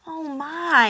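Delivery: high, loud little gasp of surprise; surprised exclamation. A narrator's reading. Rabbit startled by Old Horse's words, loud and high. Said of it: "exclaimed the rabbit".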